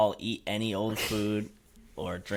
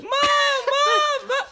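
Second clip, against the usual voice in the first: much higher, louder mock cry for mom; mock scream-cry; 8 dB louder, much slower than usual. Sister spotting a bug in her room, mock screaming for mom. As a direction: wail for mom like a scared kid. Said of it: "then she starts crying, like".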